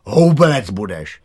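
loud, hard put-down; harsh retort. A narrator's reading. Boy rebuffs the girl who wants to join, harsh. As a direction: shoot the request down firmly. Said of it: "tvrdě opáčil Láďa".

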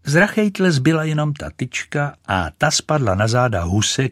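plain reading voice

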